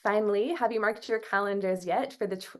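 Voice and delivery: normal talking voice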